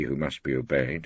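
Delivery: ordinary delivery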